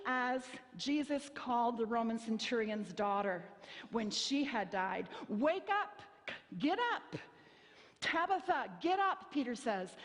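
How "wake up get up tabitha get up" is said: raised, urgent command to wake; commanding call. Retold or imitated. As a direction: call her up from death. Peter raising Tabitha from dead.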